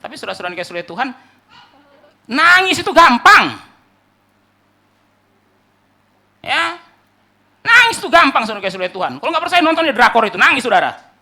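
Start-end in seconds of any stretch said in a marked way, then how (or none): 2.3-11.1 high, loud preacher's exclamation; full-force preaching. Acted. Preacher telling congregation crying is easy, full-force preaching. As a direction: belt the point out at the congregation.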